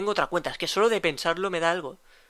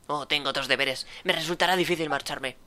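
mocking imitation; 3 semitones lower than usual. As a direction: mimic his words back with scorn. Solid mocks the officer's excuse.

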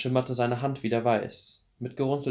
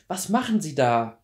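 louder, demanding question; 5 semitones higher, 6 dB louder than usual. Acted. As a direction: demand an answer. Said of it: "in forderndem Ton".

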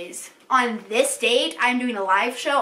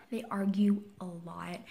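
hushed, voiceless aside; whisper; 5 semitones lower, 9 dB quieter than usual. Real, not acted. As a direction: whisper it so nobody overhears.

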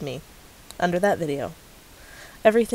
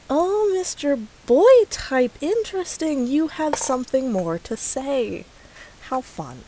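mock sweet sarcasm; 7 semitones higher than usual. Real, not acted. Mock greeting to commenter.

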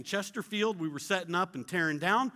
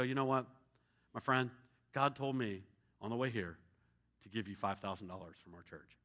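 soft, confiding; 9 semitones lower, 8 dB quieter than usual. Retold or imitated. Telling the planter about the gift.

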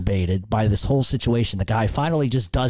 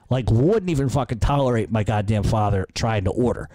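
ordinary delivery

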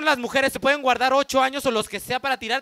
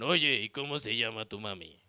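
Batman voice; 13 semitones lower, 7 dB quieter than usual. Retold or imitated. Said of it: "Batman le pregunta".

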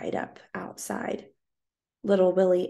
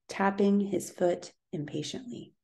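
plain reading voice

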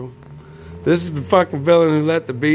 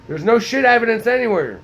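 indignant shout; 6 semitones higher than usual. Real, not acted. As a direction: demand proof like you've been wronged. Ranting, demanding evidence.

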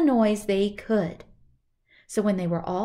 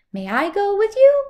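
high, loud rooster; 11 semitones higher, 11 dB louder than usual. Acted. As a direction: crow the question up high. Rooster asks to join.

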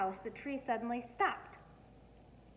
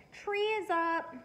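slow, higher, louder call across the house; 9 semitones higher, 5 dB louder than usual. A narrator's reading. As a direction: call everyone in, drawn out. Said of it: "Daddy called".

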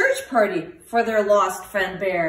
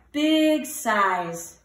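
plain reading voice

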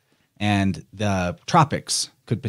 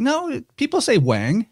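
mom insisting; 14 semitones higher than usual. Retold or imitated. Mom insisting, retold story.

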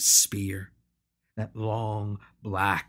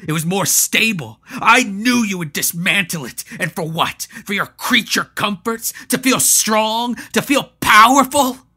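builds from higher and louder to a full shout; rising fury; 10 semitones higher, 11 dB louder than usual. Acted. Patro accusing Hanno, building to a furious shout. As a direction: hammer each accusation harder till shouting.

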